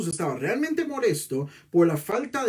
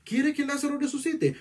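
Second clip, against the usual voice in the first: high challenging questions; 9 semitones higher than usual. Acted. Preacher voicing Jesus' challenge.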